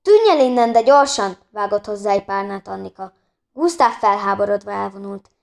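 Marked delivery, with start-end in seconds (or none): 0.0-1.4 louder, higher angry yell at the rooster; angry shout. Acted. Annika throws pillow at rooster.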